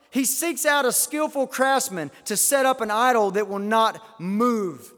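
declaimed scripture. Real, not acted. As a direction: read the verse out with force.

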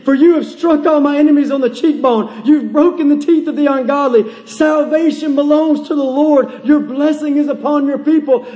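fervent, insistent exhortation; impassioned preaching. Real, not acted. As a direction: press the congregation with rousing conviction. Preaches at full force.

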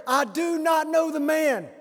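high, slow, loud cursing denial; swearing denial. Acted. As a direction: swear it out in panic. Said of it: "began to invoke a curse on himself and to swear".

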